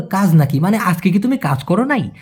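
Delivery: ordinary talk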